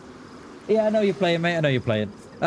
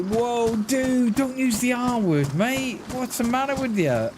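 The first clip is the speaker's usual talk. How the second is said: retold protest; 8 semitones higher than usual. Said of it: "I was like".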